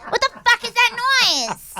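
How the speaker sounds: high, drawn-out comic yell; exaggerated complaining shout; mock yelling for Dad. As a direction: holler it high at Dad over a screeching noise. Imitating kid yelling over dial-up noise.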